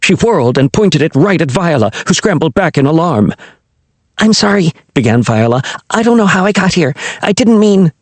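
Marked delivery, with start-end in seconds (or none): 4.2-7.9 high, fast, panicked; flustered apology. A narrator's reading. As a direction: blurt it out in alarm. Viola startled at gunpoint.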